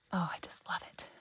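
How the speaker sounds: soft breathy whisper of love; whispered delight. Real, not acted. Gardener gushing over balloon flowers to viewers, whispering. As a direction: breathe it out like a secret.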